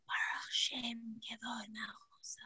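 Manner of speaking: put-on hushed child's whisper; imitating kids whispering the line. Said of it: "They go".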